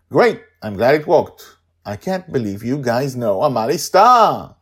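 high, loud delighted gushing; thrilled fan. A narrator's reading. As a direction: gush with relief and glee. Sandra thrilled after cure.

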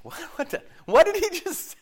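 high, stammering disbelief; stammer, then burst out high and incredulous; mock shock. Office reacting to a colleague's confession, stunned.

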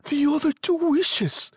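high-pitched, eager answer; quick reply. A narrator's reading. Wife answering her puzzled husband, eager. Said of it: "she replied quickly".

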